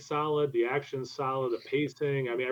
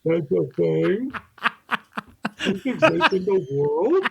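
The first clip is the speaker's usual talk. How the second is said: higher, louder, slow incredulous woman's voice; mock woman's voice; 5 semitones higher, 5 dB louder, slower than usual. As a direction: ham up a shocked woman, drawn out. Mocking his impression of wife.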